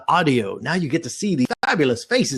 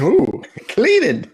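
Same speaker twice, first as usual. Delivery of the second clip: mock impressed; 8 semitones higher, slower than usual. Teasing friend about cleaning.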